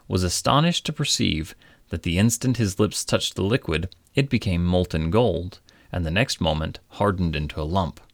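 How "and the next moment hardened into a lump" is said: usual speaking voice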